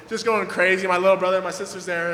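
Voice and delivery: ordinary talk, nothing marked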